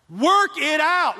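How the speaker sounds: slow, drawn out, each word weighed; slow emphatic repeat. Shown as a demonstration. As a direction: let every word land slowly. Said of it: "repeats, slowed for emphasis".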